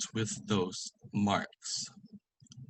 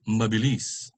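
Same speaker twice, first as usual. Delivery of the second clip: MABILIS with stressed LIS; final-syllable stress. Shown as a demonstration. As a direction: put the weight on LIS in MABILIS. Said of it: "the accent is on the last one".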